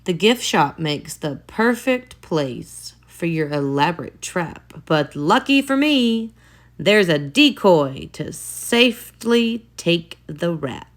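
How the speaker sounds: plain reading voice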